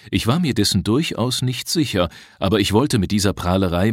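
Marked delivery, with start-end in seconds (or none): none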